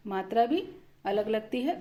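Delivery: normal talking voice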